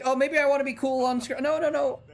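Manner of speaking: high-pitched imitation of a flustered guy; mock embarrassed. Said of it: "then he gets embarrassed again".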